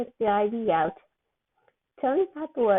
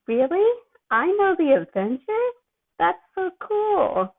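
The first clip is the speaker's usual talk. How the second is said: much higher and louder, slowing on the last words; dopey amazement; 9 semitones higher, 5 dB louder than usual. Acted. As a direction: gasp in loopy wonder at knowing heroes. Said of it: "Peter's eyes were huge as he gasped".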